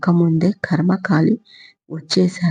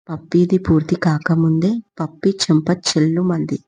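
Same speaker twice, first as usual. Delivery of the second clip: plain reading voice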